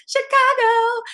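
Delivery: high, loud cheer of the city's name; excited shout. Real, not acted. Streamer cheering a viewer from her hometown, loud and high. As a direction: greet a fellow Chicagoan with a whoop.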